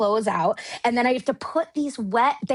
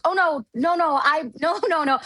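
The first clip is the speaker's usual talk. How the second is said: flustered protest; 6 semitones higher than usual. Retold or imitated. Correcting a botched introduction.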